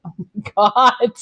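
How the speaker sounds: drawn-out stunned oh my God; mock-stunned groan of disbelief; slow disbelief. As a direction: let it sink in, aghast and slow. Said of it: "And I'm like".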